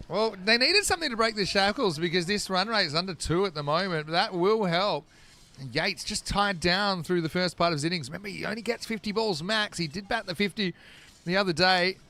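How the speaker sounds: brisk, lifted cricket call over the action; live play-by-play. Acted. Commentator breaking off the chat to call the shot, lively play-by-play. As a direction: call the shot as it happens, bright and quick.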